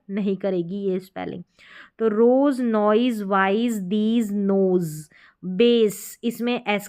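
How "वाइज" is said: ordinary talk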